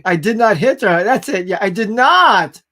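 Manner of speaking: loud, hammed-up outburst from The Room; loud mock movie denial, stretched; Tommy Wiseau line. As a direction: bellow the denial like a bad movie actor.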